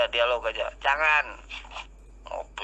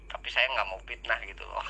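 ordinary talk, nothing marked

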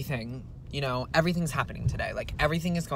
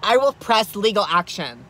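loud, higher threatening rant; angry threats; 5 semitones higher, 7 dB louder than usual. Acted. Driver threatening the laughing man who filmed her, angry shouting. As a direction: threaten him like you mean it.

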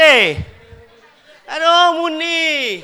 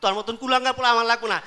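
ordinary talk

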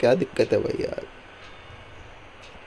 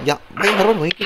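exasperated complaint; 6 semitones higher, faster than usual. Real, not acted. Keeps slipping same spot.

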